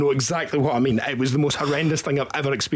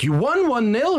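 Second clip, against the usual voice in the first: surprised question; 10 semitones higher, 3 dB louder than usual. Retold or imitated. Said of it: "And he was like".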